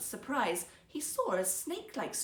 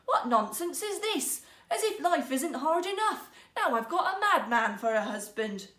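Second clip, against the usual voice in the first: higher, louder, bitterly exasperated; bitter exasperation; 5 semitones higher, 6 dB louder than usual. A narrator's reading. Wife scolding her husband, bitterly disappointed and exasperated. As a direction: scold him for talking nonsense. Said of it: "bitterly disappointed".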